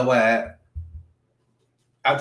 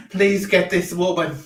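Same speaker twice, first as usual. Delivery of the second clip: ordinary delivery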